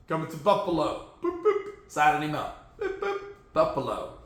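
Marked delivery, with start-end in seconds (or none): 1.2-1.6 high playful boop; boop sound. Pretend. 1.9-4.2 laughing. Real, not acted. Laughing while announcing.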